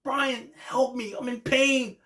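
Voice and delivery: mimicking Wheeler, high and slow, calling to Brian; mock begging. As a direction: beg your mentor for help, hammed up. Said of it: "bro was like".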